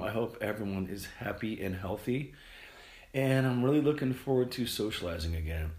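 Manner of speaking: usual speaking voice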